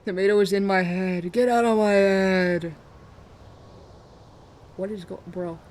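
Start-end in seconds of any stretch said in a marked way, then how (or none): 0.0-2.7 mock tormented shout. Streamer ordering Tomato out of their head, mock anguished yelling. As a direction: yell it out in hammed-up torment.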